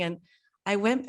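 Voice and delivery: usual speaking voice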